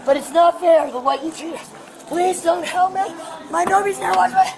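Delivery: loud desperate begging; shouted pleading. Real, not acted. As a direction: yell your plea at the officer. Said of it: "You wanna scream at me some more?".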